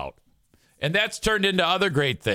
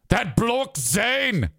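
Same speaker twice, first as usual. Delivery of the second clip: slow, higher, hammed-up Scottish accent; mock Scottish accent; 5 semitones higher, much slower than usual. Shown as a demonstration. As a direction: put on a cartoon Scottish brogue, drawn out. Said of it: "Scottish is like this".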